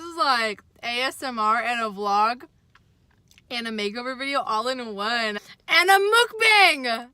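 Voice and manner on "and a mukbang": loud, high, slow exclamation; excited shout. Real, not acted. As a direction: shout it out with glee.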